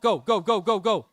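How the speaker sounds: loud, rising chant of go; excited cheering. Real, not acted. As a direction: urge the player on at full voice. Cheering a teammate's drive.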